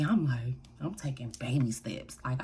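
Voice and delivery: ordinary delivery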